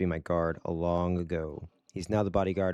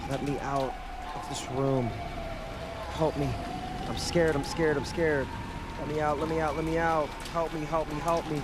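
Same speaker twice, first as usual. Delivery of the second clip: panicked; 5 semitones higher than usual. Acted. Trapped girl begging to be let out, high and panicked. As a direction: build into frantic pleading.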